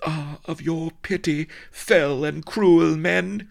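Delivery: high, melodramatic damsel's plea; crying out. A narrator's reading. Vanity pleading with cruel pirates, high melodramatic cry. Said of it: "she cried".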